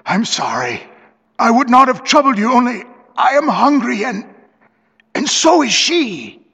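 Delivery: high-pitched, pitiful pleading; hopeless and pathetic. A narrator's reading. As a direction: plead feebly in a high, forlorn voice. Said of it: "sounding extremely hopeless and pathetic".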